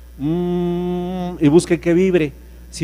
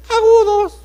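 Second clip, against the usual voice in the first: much higher and louder falsetto; 9 dB louder, slower than usual. Shown as a demonstration. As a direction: shoot agudos way up high. (agudo, en falsete)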